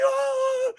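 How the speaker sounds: very high drawn-out dog noise; long high mock howl; imitated dog howl. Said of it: "Loki was like".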